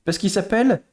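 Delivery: usual speaking voice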